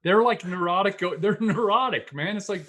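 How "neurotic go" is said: ordinary talk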